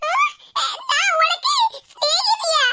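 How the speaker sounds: high, slow, outraged cry; indignant shout. Acted. Mother-in-law outraged at being called friend, shouting. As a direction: shriek it out in mock outrage.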